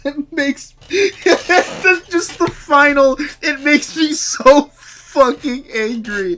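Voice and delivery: real helpless laughter; cracking up. Real, not acted. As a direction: try to read it but keep losing it. Trying to repeat quote, cracking up.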